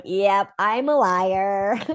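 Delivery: drawn-out confession, slow; a slow mock confession; slow admission. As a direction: stretch out the admission slowly. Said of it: "I'm like".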